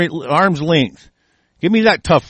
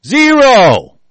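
one high, loud blast of a word; loud shout; 9 semitones higher, 8 dB louder than usual. Real, not acted. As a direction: slam the word down like a verdict. Emphatic rant peak.

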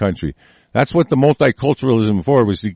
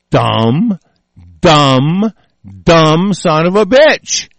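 each dumb louder, ending in a slow loud bitch; building angry emphasis; 3 semitones higher, 4 dB louder, much slower than usual. Real, not acted. Ranting viewer cursing out Mao, rising to a slow loud jab. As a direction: hammer the insult harder each time. Ranting about Mao's stupidity.

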